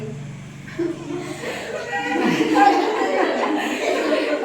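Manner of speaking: can't hold back the laugh; helpless student giggle; real giggling. Real, not acted. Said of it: "हंसा नहीं".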